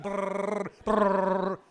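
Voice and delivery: rolled-lip brrr of a shaking plane; imitated rattling rumble. Pilot imitating the plane shaking forward, rolled brrr. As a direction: mimic the whole jet juddering.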